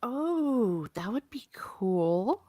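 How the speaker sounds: a slow hushed whisper; whispered aside. Real, not acted. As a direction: breathe it out slowly like a secret. Reacts softly.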